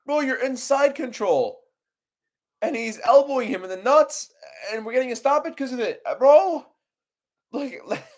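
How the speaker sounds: very high-pitched, pleading disbelief; incredulous high whine. Real, not acted. Streamer complaining about a foul stoppage, incredulous high whine. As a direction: whine high as if beyond belief.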